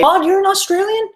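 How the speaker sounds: high-pitched burst of surprise; surprised. Real, not acted. Vlogger finds out a viewer is Australian, surprised. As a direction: exclaim it high, caught by surprise.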